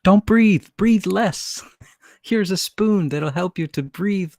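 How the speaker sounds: very high, loud cartoonish mockery; absurd mocking parody. Mocking health advice, very high and loud, as a joke. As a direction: parody the advice as a ridiculous shrill announcer. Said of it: "It's like".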